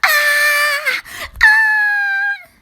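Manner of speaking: very high, loud shrieks; wordless tantrum screams. A narrator's reading. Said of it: "Taylor screamed louder".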